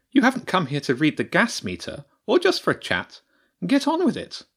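high, rising, brusque badger voice; impatient badger priestess. The badger priestess pushing James to get to the point, high and curt.

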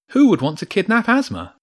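loud, high-pitched disbelief; incredulous question. A narrator's reading. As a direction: ask it in shocked disbelief. James shocked at kidnapping news.

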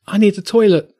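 put-on high, childish whine of a small boy; little boy voice. A narrator's reading. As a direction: pipe up like a kid who can't wait. Arlo, a little kid, interrupting.

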